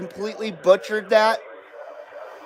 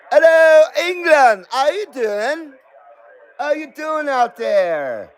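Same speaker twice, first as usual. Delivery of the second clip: higher, hammed-up English accent; attempted English accent; 5 semitones higher than usual. Streamer greeting viewers in England, hammed-up English accent. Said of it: "That's my best impression of an English accent".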